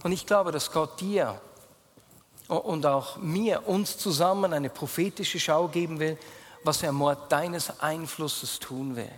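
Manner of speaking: ordinary talk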